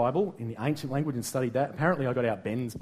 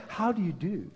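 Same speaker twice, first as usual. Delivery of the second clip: posh greeting; 3 semitones higher, 5 dB quieter than usual. Retold or imitated. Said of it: "fancy schmancy people say".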